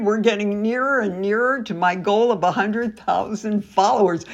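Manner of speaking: usual speaking voice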